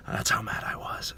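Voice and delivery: hushed unvoiced aside; whispering. Real, not acted. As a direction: whisper it like a secret. Aside after ranting quote.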